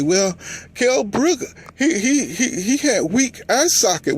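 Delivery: high, whiny-pitched mimicry of apologists; mock excuse-making. Fans excusing Kell Brook's loss, high mocking imitation.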